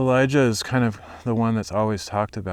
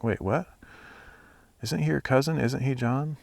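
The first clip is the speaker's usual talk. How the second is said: confused disciples; 6 semitones higher than usual. Retold or imitated. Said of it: "They must have been like".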